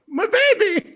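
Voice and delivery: squealed, very high and loud cry of joy; tearful joy. Acted. [name] tearing up as the boy thanks her, squealing with joy. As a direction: gush over him like a proud weepy mother.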